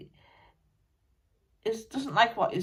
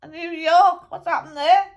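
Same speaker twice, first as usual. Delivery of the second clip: lightly voiced blunt old British musician, higher and louder than the medium; a dead rocker grumbling through a medium; channelled musician spirit; 4 semitones higher, 9 dB louder than usual.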